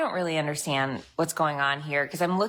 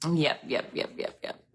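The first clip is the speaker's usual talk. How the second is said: fading to whisper; 4 dB quieter than usual. Real, not acted. Fading repeated yeps.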